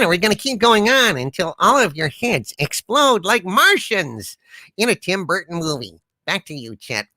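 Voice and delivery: lightly put-on voice of a relentless joker; defiant joker character. Joke-teller answering a weary viewer in the chat, lightly voiced defiance. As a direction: interrupt as a comic who refuses to stop.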